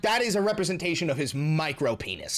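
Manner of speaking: ordinary talk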